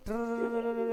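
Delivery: imitating a rattling noise; rolled r. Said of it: "ऐसा कुछ आवाज आ रहा है?".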